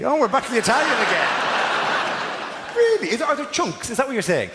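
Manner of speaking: high, shrill-free squeak of disbelief pushed up high; incredulous. Real, not acted. To the surgeon about the soup, high and amazed. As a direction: shoot the voice way up in mock amazement. Comic reacting to minestrone answer.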